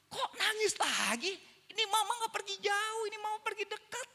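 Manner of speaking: high-pitched baffled protest; bewildered mother. Retold or imitated. Mother puzzled that her son cries again, bewildered. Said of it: "mamanya bingung".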